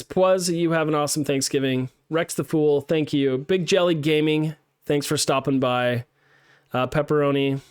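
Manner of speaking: usual speaking voice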